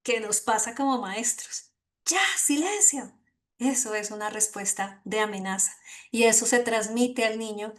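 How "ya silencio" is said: mock teacher's yell. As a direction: imitate a threatened teacher calling for silence. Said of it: "se siente en amenaza y grita". (grito fingido de maestra, en tono agudo)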